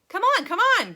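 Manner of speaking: high, loud urging shout; impatient loud urging; loud call. A narrator's reading. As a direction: hurry them along with a bright loud call. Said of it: "cried Mister Wonka".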